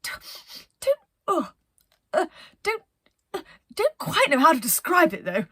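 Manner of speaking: captain hunting for a word for the smell; flustered captain. Acted. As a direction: stumble over the words, lost for a description. Penelope fumbling for words.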